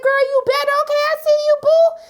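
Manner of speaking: put-on high, loud hype girl cheer; excited cheering. Cheering Keisha at the screen, high excited hype. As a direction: cheer her on like a best friend.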